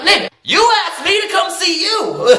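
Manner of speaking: half-laughing indignant shout; laughing mock shout. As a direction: yell it back through laughter. Retelling heated quote to boyfriend.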